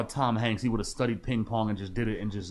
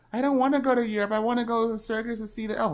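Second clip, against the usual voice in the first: put-on much higher child voice, fast at first; whiny daughter voice; 11 semitones higher, faster than usual. Daughter begging her father, high whiny child voice. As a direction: whine it like a spoiled kid. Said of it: "curses out the daughter".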